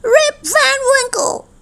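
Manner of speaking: very high, eerie far-off cry; strange calling voice. A narrator's reading. Mysterious stranger calls to Rip on the mountain, high strange call. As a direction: call out the name in a high, unearthly voice. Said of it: "the voice cried".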